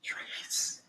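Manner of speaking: breathy hushed one-word hint; whisper. Retold or imitated. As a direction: whisper the answer to the teacher. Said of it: "someone whispered".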